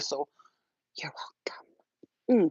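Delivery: normal talking voice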